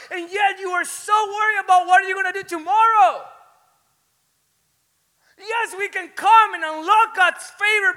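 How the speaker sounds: high, very loud impassioned shouting; full-force preaching. Real, not acted. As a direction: shout it to shake the congregation awake.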